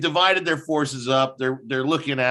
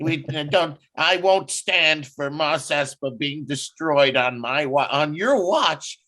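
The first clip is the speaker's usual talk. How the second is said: higher-pitched mock of a pathetic official; raised-pitch, mocking indignation; pathetic mayor; 6 semitones higher than usual. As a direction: mimic a weaselly official's pompous protest. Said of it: "in this scene is so pathetic".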